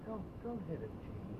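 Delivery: put-on a frail old man begging softly; weak voice. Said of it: "in this weak voice".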